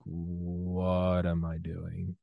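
drawn-out, very slow question; doubting himself. Acted. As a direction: stretch it out as if dazed by self-doubt. Voicing own doubt on bad days.

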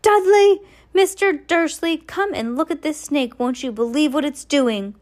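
high, loud yell across the room; deafening shout. A narrator's reading. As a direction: shout for them to come. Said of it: "a deafening shout".

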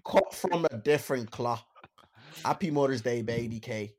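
mock Irish accent; hammy Dublin accent reading. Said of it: "let me read them out in Dublin accent".

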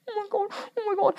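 high, breathless mock panic; pulled over by the police, retelling her frightened squeal; retold panic. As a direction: squeak it out in a half-joking panic.

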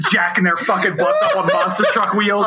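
ordinary talk, nothing marked

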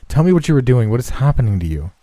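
loud, high, fast demand; urgent cry. Acted. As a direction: press the ghost frantically for an answer. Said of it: "he cried".

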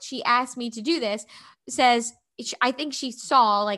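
ordinary talk, nothing marked

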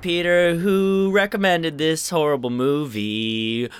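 game show host. Pretend. Game show intro bit.

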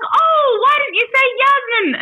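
high, loud mock exasperation; hammed-up relieved telemarketer; exasperated caller. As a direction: caller finally getting the name. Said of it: "She was like".

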